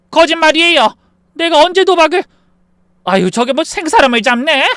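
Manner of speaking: very high, loud protest; outraged denial. Acted. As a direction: cry slander, indignant and defensive. Mother-in-law loudly denies gambling.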